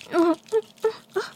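rising pleasured moans; high moans. Acted. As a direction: moan up high. Pleasure sounds.

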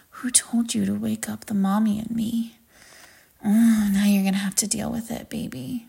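breathy, playful whisper of a threat; teasing whisper. Acted. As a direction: whisper it close, teasing and possessive.

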